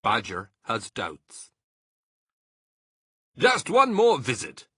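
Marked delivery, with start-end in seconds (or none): 3.4-4.7 high, loud eager cry; Mr Fox crying out. A narrator's reading. Mr Fox urging the others on, loud eager cry. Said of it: "cried Mister Fox".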